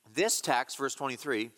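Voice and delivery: ordinary talk, nothing marked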